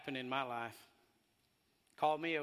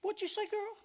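sassy mom challenge. Retold or imitated. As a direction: dare her to repeat it. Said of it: "that attitude of authority".

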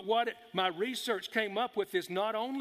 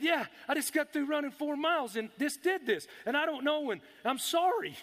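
high-pitched, rattled explaining; acted-out embarrassed excuse, pitched well up; flustered apology; 8 semitones higher than usual. Flustered apology to 911 dispatcher.

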